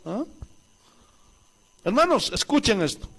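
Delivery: ordinary talk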